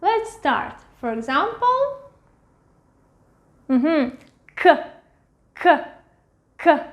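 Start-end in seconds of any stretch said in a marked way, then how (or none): 4.5-6.8 "K" said as a crisp Russian К sound, repeated; sound demo. Shown as a demonstration. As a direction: clip "K" out as a short hard sound. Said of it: "I'm going to point to the sound, try to make it".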